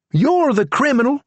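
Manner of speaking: deputy insulting the prisoner; Charlie's voice. A narrator's reading. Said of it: "Charlie added".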